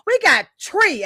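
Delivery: put-on Jamaican accent, "tree" with no th sound. Host counting guests in a Jamaican accent. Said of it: "as they say in Jamaica".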